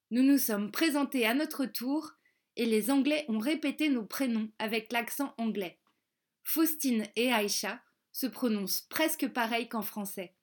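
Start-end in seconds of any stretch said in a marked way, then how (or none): none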